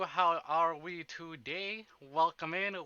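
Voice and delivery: usual speaking voice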